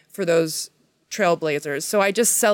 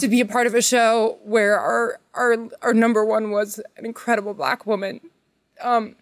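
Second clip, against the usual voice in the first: close to tears; 4 semitones higher than usual. Real, not acted. Honoring her lead actress, on the verge of tears. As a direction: voice pitched up, choked with emotion. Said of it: "God, I'm gonna cry".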